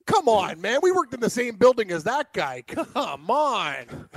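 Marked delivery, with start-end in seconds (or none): none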